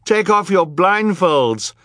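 high, loud, slowed urgent plea; begging cry. A narrator's reading. Violet begging the blindfolded crowd, high loud plea. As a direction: beg them at the top of your voice. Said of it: "Violet begged the crowd".

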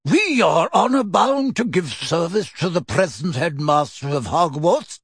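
a hint of a frail old man; lightly voiced frail elder crying out; frail old wizard. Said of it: "cried a frail-looking old wizard".

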